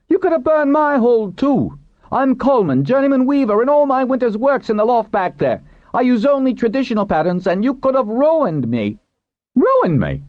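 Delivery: very high, agitated protest; outraged weaver. A narrator's reading. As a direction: protest your near ruin, voice way up. Weaver confronts would-be arsonists.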